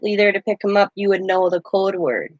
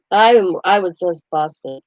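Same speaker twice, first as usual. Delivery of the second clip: ordinary delivery, nothing marked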